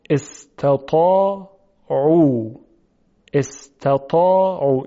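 استطاعوا recited slowly with heavy ط; slow model recitation. Shown as a demonstration. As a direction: draw out استطاعوا carefully.

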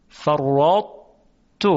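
فرطت drawn out slowly, final syllable rising; slow tajwid recitation; slow recited example. Shown as a demonstration.

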